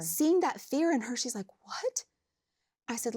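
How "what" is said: high-pitched startled question; retold frightened "what?"; imitated scared client. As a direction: mimic her alarm, pitch leaping up. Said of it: "she was scared".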